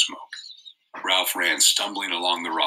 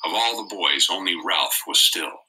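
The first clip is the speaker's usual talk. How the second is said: usual speaking voice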